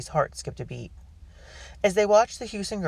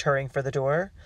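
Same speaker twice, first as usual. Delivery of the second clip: plain reading voice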